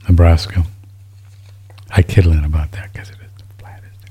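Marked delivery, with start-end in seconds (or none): 1.9-4.0 a soft, breathy whisper tucked in as a joke; whispered aside. Real, not acted. Host whispers a teasing joke about a friend's flat state. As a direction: whisper it like a private tease.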